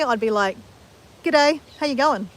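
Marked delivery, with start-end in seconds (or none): 1.2-2.3 her everyday Australian "G'day, how you going", easy and unforced; normal Australian. Shown as a demonstration. Showing her everyday Australian greeting, relaxed. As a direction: say "G'day" the way she normally would. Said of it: "if I was gonna say that normally".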